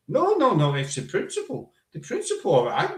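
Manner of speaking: mock indignant protest, high-pitched at the start; retold arguing. Man arguing with a bouncer over his shoes, mock indignant. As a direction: imitate a mate objecting, pitch leaping up on the no's. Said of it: "he was arguing with the bouncer".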